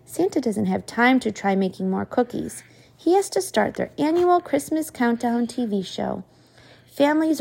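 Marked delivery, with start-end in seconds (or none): none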